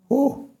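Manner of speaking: a high, sharp whew; shocked whew. Real, not acted. Preacher reacting to Bildad blaming Job's children, a high whew. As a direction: let out a stunned whew.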